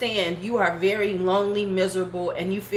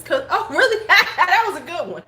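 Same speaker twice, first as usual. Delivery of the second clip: laughing, higher and louder; 8 semitones higher, 5 dB louder than usual. Real, not acted. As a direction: laugh through the words. Laughing at comment.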